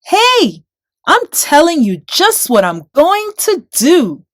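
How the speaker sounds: taunting shout. A narrator's reading. David shouts his taunt up at Goliath. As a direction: shout the threat at the giant.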